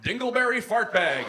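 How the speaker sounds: punchline shout. Acted. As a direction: yell the silly name. Blurting the fake real name.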